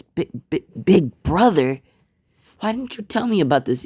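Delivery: mother's astonished, high-pitched protest; shocked stammer. Acted. Mother shocked to learn of big brother.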